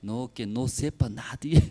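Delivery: normal talking voice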